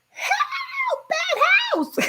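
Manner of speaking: high laughing yell; retold cry for help, laughing through it; mock scream for help. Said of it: "in the window screaming".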